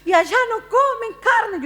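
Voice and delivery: high-pitched mock lament; pitying pastor. Pastor pleading for missionaries' offering, high mock-pitying wail. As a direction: exaggerate the pastor's pleading pity. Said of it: "para pedir una ofrenda por nosotros y dice".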